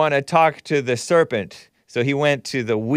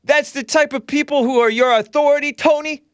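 heated yelling; 10 semitones higher than usual. Real, not acted. Heated call-in argument.

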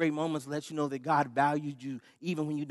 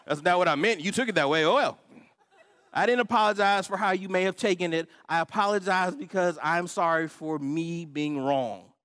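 loud, raised, emphatic arguing; insistent apology; 4 semitones higher, 6 dB louder than usual. Retold or imitated. Husband arguing how he apologizes to wife, insistent. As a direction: push it out louder and higher, making the point.

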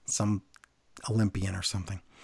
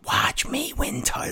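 higher, whispered mock catchphrase; whispered quote; 12 semitones higher, 5 dB quieter than usual. Mocking Liv's catchphrase in a whisper. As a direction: breathe it out mockingly. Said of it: "whatever you said".

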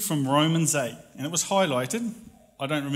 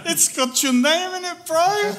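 higher, louder mocking bro voice; teasing buddy; 8 dB louder than usual. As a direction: rib him like a loud teenage mate. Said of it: "And he goes".

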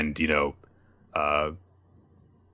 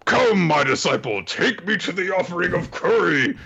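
a hint of a solemn demon lord; grand demon; 10 semitones higher, 3 dB louder than usual. Demon asking his disciple for curry, lightly grand. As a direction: command it grandly but lightly. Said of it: "So he says".

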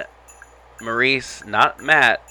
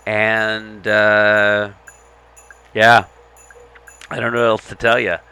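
plain reading voice

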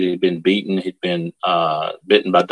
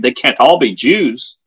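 mild mock scream, higher and slow; raised, drawn-out disbelief from an enraged major; retold scream; 5 semitones higher, 5 dB louder than usual. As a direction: exclaim it slowly as a retold scream. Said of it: "He screamed".

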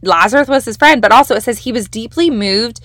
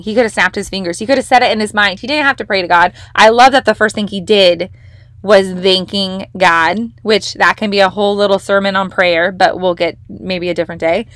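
ordinary delivery

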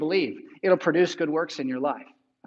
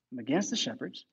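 a much softer repeat; soft echo; 5 semitones lower, 10 dB quieter than usual. Real, not acted. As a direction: drop the voice for the echo. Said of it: "repeated much softer".

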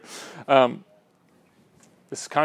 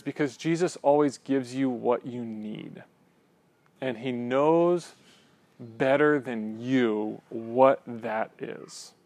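slow quoted line; 3 dB quieter, slower than usual. Retold or imitated. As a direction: read each word slowly and deliberately, lingering on it. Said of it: "such a great quote".

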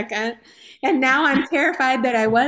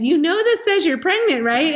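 higher and louder, imitating his shock; husband's stunned disbelief; 8 semitones higher, 5 dB louder than usual. Husband waving the test at his wife, stunned and loud. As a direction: play the husband who can't believe the test.

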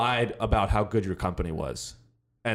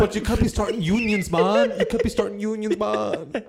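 higher, loose surfer-dude boss voice; stoner Jeff Bezos; 8 semitones higher than usual. Retold or imitated. Bezos as a stoner boss telling workers off, high and laid-back. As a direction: play a chill billionaire scolding unions. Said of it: "I'm Jeff Bezos".

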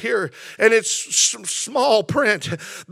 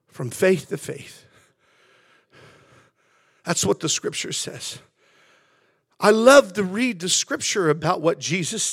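hushed preaching; 2 semitones lower, 5 dB quieter than usual. Real, not acted. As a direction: drop right down and let the scripture settle, pausing between phrases.

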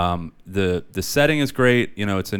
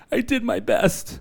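much higher, squeaky repeat; 14 semitones higher than usual. Shown as a demonstration. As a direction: squeak it out playfully.

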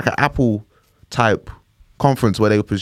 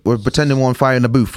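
ordinary delivery

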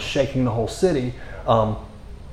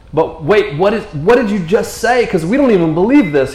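raised, insistent questioning; acted disbelief; 5 semitones higher, 4 dB louder than usual. Hearers question Jesus' claim, mock incredulous. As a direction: challenge it as if taken aback.

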